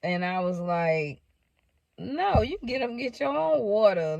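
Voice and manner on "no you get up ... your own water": playfully sassy, high-pitched comeback; high, mock-bossy teasing; playful retort. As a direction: toss it back as a teasing refusal. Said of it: "in a playful way".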